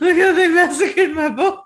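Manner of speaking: loud, high, hammed-up wail; mock tearful movie quote. Echoing the tearful film quote.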